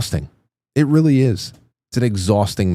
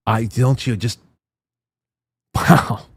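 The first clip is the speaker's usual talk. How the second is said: normal talking voice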